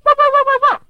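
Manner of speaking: very high, loud yapping chihuahua; shrill chihuahua barks; tiny dog yapping. Retold or imitated. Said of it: "on the other side of you going".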